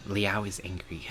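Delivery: hushed, breathy whisper. Acted. Repeating his words to herself in a whisper. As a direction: say it again only on breath.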